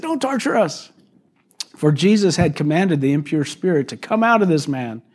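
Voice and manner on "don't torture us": high, whiny "Don't torture us"; pitched-up imitation of the demons pleading; mock demon plea. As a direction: voice the demons begging, hammed up a little. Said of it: "they said".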